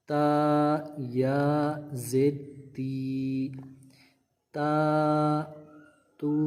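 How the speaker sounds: slow, stretched syllables; spelling drill. Shown as a demonstration. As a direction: spell then read تا تو تی with long vowels. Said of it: "پہلے ہجے کرنے ہیں، پھر رواں پڑھنا ہے".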